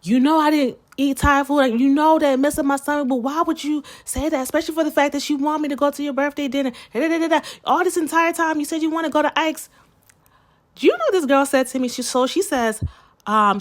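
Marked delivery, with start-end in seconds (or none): 0.0-9.7 high, fast, heated complaint; heated retelling. Retold or imitated. Fed up with friend over Thai food, fast and heated. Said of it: "trying to piss me off because I kept on saying".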